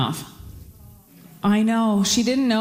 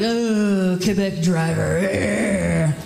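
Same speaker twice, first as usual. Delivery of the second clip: drawn-out groan at another driver; parents' disgusted groan; much slower than usual. Acted. Parents get cut off in traffic, groaning in disgust. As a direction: groan the words out long and exasperated. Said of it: "my parents would be like".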